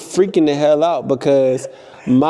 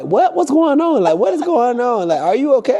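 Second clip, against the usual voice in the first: put-on alarmed boyfriend; 10 semitones higher than usual.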